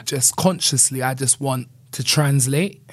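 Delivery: ordinary talk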